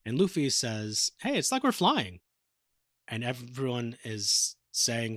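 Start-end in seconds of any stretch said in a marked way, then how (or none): 1.2-2.2 high-pitched mock glee; playful excitement. Luffy to the crew on a flying boat, playful excitement. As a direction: imitate a carefree character delighted by the ride.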